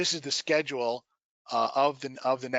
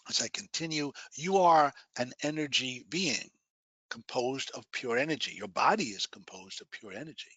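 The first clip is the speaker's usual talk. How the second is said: guided meditation. Acted. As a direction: guide them gently into relaxation.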